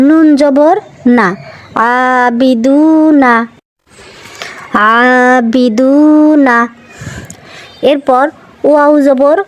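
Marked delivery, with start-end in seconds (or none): 1.9-3.3 আবিদুনা very slow, the long vowels stretched; slow drawn-out recitation. Shown as a demonstration. Teacher reciting আবিদুনা slowly for pupils. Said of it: "teacher demonstrating madd lengthening". 4.9-6.6 আবিদুনা very slow, the long vowels stretched; slow drawn-out recitation. Shown as a demonstration. Teacher reciting আবিদুনা slowly for pupils. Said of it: "repeats word slowly for pupils".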